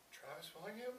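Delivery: imitating an unsure fan; tentative fan recognition. Fan recognizing him in store.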